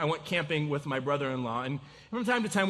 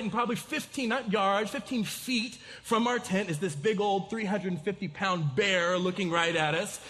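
ordinary delivery

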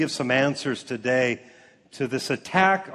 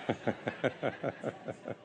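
breathy laugh; 5 semitones lower, 14 dB quieter than usual. Real, not acted. Laughing at own joke.

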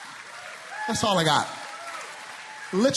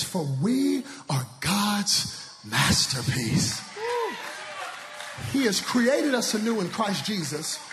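scripture proclamation; slower than usual. Acted. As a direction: proclaim the verse slowly.

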